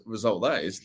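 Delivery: normal talking voice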